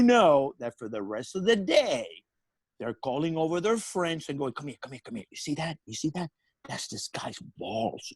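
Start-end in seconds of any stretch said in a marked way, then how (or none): 4.5-6.3 hushed, hurried beckoning; furtive summons. Retold or imitated. Guards calling their friends over to look, hushed. 6.7-8.1 slow, near-whispered reveal; hushed gossip. Retold or imitated. Guards pointing him out to their friends, hushed.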